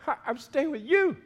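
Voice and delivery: a determined no, voice lifted; firm refusal. Retold or imitated. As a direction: say it like a firm no to temptation. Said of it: "we say".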